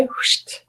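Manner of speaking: short, unvoiced rush of air; quick whoosh of an arrow flying in. Teacher showing the first arrow coming in, imitated whoosh.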